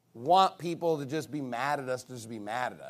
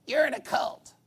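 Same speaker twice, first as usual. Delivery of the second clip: high, yelled jeer; hammed-up accusing yell; mock accusation; 8 semitones higher than usual. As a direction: imitate a heckler shouting an insult.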